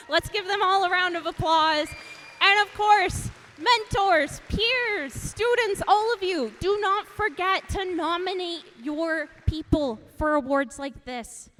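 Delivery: high, loud cheerleading call; rally the room to applaud; excited cheering. Real, not acted.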